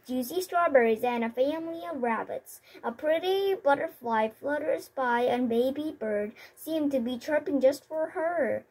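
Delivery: ordinary delivery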